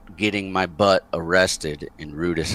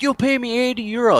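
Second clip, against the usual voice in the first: high mock-angry demand; insistent bar owner; faster than usual. Bar owner in his face demanding payment, mock angry. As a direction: press for payment in the owner's raised voice. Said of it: "gets right in my face".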